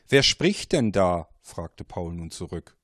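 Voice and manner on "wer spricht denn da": louder, alarmed question; startled question. Acted. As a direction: demand to know who it is. Paul startled by caller.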